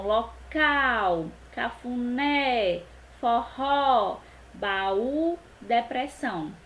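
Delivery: "local, cafuné, forró, baú, depressão" with each final syllable leaned on; slow word list, "local, cafuné, forró, baú, depressão", final syllables held; last-syllable stress. Shown as a demonstration. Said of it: "oxítona quando a última sílaba for a mais forte".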